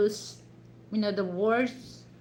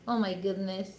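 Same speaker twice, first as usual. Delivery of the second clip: ordinary talk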